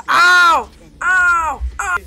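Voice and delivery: very loud, high repeated yelps; yelps of pain. Real, not acted. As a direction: cry out as if hit, high and loud. Hit during the game.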